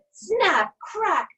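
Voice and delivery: sharp high loud snap, crack. A narrator's reading. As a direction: bark out the breaking bridge. Bridge breaking sound.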